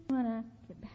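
hushed, secret whisper of revenge; breathed low, a temptation voiced. Voicing the urge for revenge.

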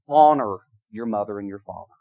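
ordinary talk, nothing marked

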